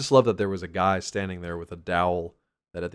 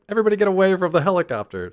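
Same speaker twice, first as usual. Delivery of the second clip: higher, louder, fast panicked order; urgent reversal; 10 semitones higher, 8 dB louder, faster than usual. Retold or imitated. Character reversing the escape order, louder panicked call. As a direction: yell them away in a hurry. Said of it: "So they're like".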